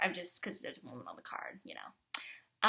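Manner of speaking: soft unvoiced whisper, half to herself; a sheepish whispered afterthought; whispered aside. Real, not acted. Quiet aside after apology.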